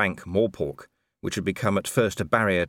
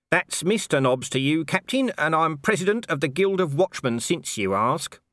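saunter in unbothered; lightly voiced Nobby; 8 semitones higher than usual. A narrator's reading. Nobby ambling in and teasing Colon, offhand.

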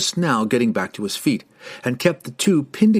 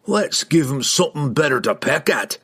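higher, rough-spoken dwarf challenge; taunting dwarf; 4 semitones higher than usual. A narrator's reading. Dwarf readies his axe, taunting the riders. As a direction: invite the fight in a dwarf's voice.

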